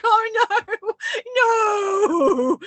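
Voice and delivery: high, loud, hammed-up wailing; re-enacted wail. Driver on the phone to lover after crashing, exaggerated wail. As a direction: cry it out long and high. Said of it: "And I was like".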